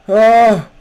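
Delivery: real jolted "oh"; startled cry. Real, not acted. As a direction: cry out at a sudden scare. Startled while lost in game.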